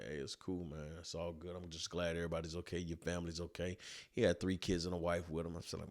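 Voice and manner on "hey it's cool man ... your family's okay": soft, low easygoing calm; calm reassurance. Retold or imitated. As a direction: keep it cool and gentle. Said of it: "I'm like".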